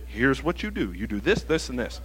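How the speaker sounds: low, soft matter-of-fact answer; calm, low-voiced divine reply; God giving instructions. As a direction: lay out simple steps quietly and evenly. Acts God calmly giving instructions.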